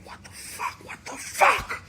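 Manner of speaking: voiceless first, then a very high squeal; mock freak-out, breath into shriek; retold shocked outburst. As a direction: act out disbelief building into a high cry. Retelling his stunned reaction in the moment.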